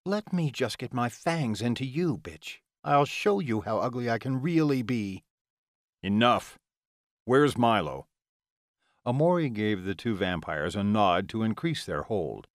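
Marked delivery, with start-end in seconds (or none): 0.0-5.2 higher-pitched vicious threat; menacing Ilona. Acted. 6.0-8.1 louder, commanding interruption; firm demand. Acted. Amori cuts off quarrel, demands.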